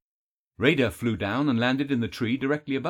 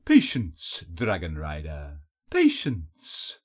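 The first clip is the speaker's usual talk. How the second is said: sly, honeyed captor toying with a prisoner; honeyed villain; 7 semitones lower, much slower than usual. A narrator's reading. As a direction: coax with honeyed, swooping menace. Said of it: "a honeyed voice spoke".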